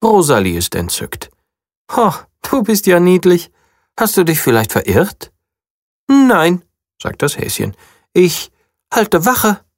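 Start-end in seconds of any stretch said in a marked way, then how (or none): 1.9-5.3 higher, sweet princess voice; lightly voiced charmed princess; delighted princess. A narrator's reading. 6.1-9.6 much higher, tiny hare voice; high small bunny; little bunny voice. A narrator's reading. Bunny character voice.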